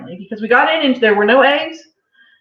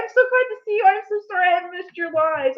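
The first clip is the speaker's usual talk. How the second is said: excited greeting; 11 semitones higher, 3 dB louder than usual. Real, not acted. Wife greets a friend joining the live, excited. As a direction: squeal hello to a long-missed friend.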